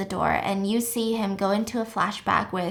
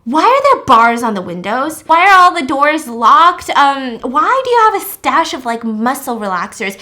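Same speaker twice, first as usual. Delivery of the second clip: loud, insistent, raised questioning; alarmed ranting; 4 semitones higher, 10 dB louder than usual. Retold or imitated. Will warns the guests of danger, loud insistent rant. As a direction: fire off accusing questions loudly.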